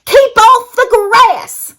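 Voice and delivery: high, loud, slow cactus shout. A narrator's reading. Hank shouting at the cowboy to keep off. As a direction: yell the order at the passerby. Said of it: "shouted Hank".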